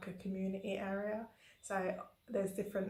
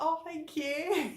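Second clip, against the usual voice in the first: high, drawn-out gushing thanks; loud, slow, melting gratitude; touched thanks; 7 semitones higher, 5 dB louder, much slower than usual. Retold or imitated. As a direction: coo it back to a sweet child. Voices own delighted reply to kids.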